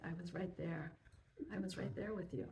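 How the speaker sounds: low, soft reassuring reply; calm father. Retold or imitated. Father reassures his frightened son, calm and soft. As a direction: answer as a quiet, steady dad. Father calmly answers the upset boy.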